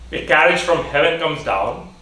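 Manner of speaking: ordinary talk, nothing marked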